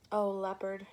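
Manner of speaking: weary call to the leopard; a worn-out woman calling out; tired voice. Acted. Tired woman calls to the leopard for help, weary voice. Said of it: "she exclaimed in a tired voice".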